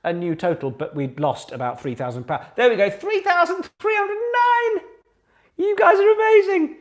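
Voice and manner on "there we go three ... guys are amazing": excited. Real, not acted. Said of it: "excited total announcement".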